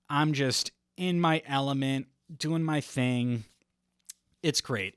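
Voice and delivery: normal talking voice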